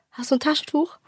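ordinary talk

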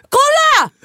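high, loud excited exclaim; mock drunk triumphant yell; triumphant shout. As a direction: shout to make them look. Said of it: "Och jag bara".

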